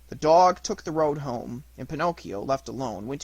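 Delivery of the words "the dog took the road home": ordinary delivery, nothing marked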